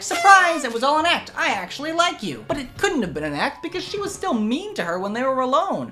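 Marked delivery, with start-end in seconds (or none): 0.0-2.4 high, perky imitation, loud at the start; mock-cheery reveal. Mentor secretly confiding in the kid, mock-cheery reveal. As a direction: burst out with a hammed-up happy surprise. Voices the mentor's friendly turnabout.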